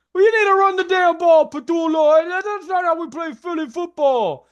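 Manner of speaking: loud, very high-pitched fan holler; heckling Philly fans. Acted. Philly fans berating Patullo over the running game, shrill high yelling. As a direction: yell indignantly like a fan berating the coach. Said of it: "hearing all the Philly noise".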